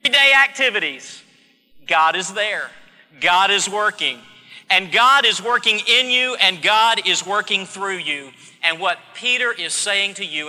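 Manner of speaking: loud, driving pulpit proclamation; fervent preaching. Acted. Preacher proclaiming to the congregation that God is working, loud and fervent. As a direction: hammer each phrase to the congregation.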